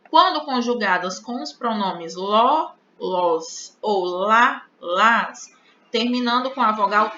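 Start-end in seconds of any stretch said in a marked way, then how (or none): none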